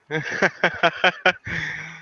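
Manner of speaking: short amused chuckle. Real, not acted. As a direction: chuckle at the joke. Laughs at spelling joke.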